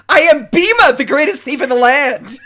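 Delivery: loud, proud boast building to a declaration; boastful proclamation. Acted. Bima boasting to the mask maker, loud and proud. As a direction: proclaim yourself the greatest thief.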